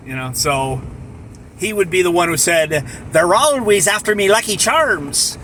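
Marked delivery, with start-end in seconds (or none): 3.1-5.4 a rough try at the leprechaun's bouncy higher line; higher, louder mock leprechaun cheer; a so-so leprechaun impression, pitched up; Lucky Charms leprechaun. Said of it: "that's not a great imitation".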